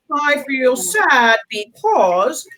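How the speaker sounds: slow, drawn-out model sentence; lightly slowed teacher demo; slow modelled feelings. Shown as a demonstration. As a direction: spell out each feeling slowly for children. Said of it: "use our words and say".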